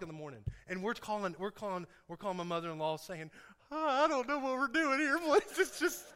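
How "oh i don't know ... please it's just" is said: high-pitched, loud mock panic; hammed-up helpless wail; frantic pleading. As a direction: play up the overwhelmed new parent begging. Panicked new parent calls mother-in-law.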